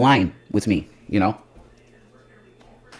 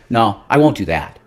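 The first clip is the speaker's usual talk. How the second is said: usual speaking voice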